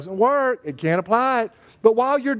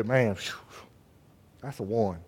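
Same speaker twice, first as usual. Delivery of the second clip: much lower and much softer, sighing it out; weary sigh; 13 semitones lower, 10 dB quieter than usual. Shown as a demonstration. The pastor demoing how he rates a one: low sighing call. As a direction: let the air out, then call it low and soft. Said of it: "I'll go".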